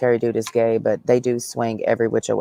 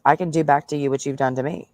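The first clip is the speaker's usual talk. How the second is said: normal talking voice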